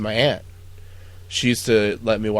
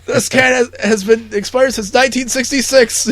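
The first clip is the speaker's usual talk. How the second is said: high, loud outraged scolding; angry yelling; 12 semitones higher, 9 dB louder than usual. Retold or imitated. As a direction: blast the cook over the can. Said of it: "yelling at Buckman".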